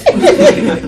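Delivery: loud high burst of laughter; big high laugh. Real, not acted. Ending the story about the knife, big laugh.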